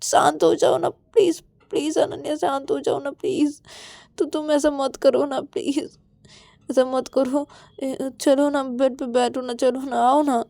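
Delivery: high, anxious begging; coaxing, high-pitched entreaty; pleading. Acted. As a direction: plead with them to calm down. Pleads with Ananya to calm down.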